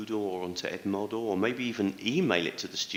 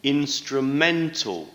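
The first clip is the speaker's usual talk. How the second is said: Instrumental slow, louder and higher, punching -men-; stress on third syllable; 4 semitones higher, 6 dB louder, slower than usual. Shown as a demonstration. Teacher modelling Instrumental for students, slow, stress on -men-. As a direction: draw out Instrumental, hitting men hard. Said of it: "Stress is on the third syllable".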